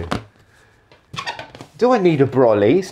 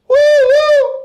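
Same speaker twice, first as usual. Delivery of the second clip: shrill falsetto woo woo, much higher and louder; high sync hoot; 7 dB louder than usual. Real, not acted. A sync noise for the camera, hooted high and loud. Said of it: "I don't know how to make a noise for the sync".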